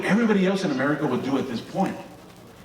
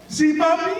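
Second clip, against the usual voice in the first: high timid little voice; meek kid; 13 semitones higher than usual. Retold or imitated. Kid handing phone to dad, high meek squeak. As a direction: squeak it out sheepishly. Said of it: "I grabbed the phone".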